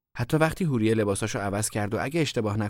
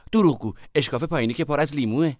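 raised, loud challenge; angry accusation; 6 semitones higher, 5 dB louder than usual. A narrator's reading. Sister to Hourieh about the lemons, angry accusation. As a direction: call out the lie, high and loud.

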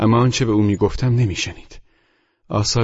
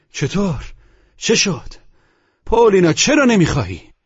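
high, incredulous cry building louder; shouting; 10 semitones higher, 4 dB louder than usual. A narrator's reading. Narrator demands why Polina refuses, shouting. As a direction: cry out in disbelief, pitch leaping up. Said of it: "من به فریاد گفتم".

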